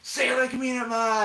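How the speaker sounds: high, far-pitched holler; imitated heckling. Heckling Ma from behind, high hollered imitation. Acting out heckling in film scene.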